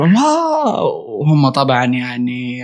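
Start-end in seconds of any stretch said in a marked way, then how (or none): 0.0-0.8 amazed "wow". Retold or imitated. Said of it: "وأقول".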